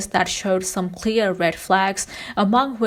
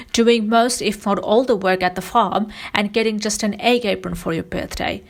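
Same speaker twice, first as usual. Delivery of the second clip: normal talking voice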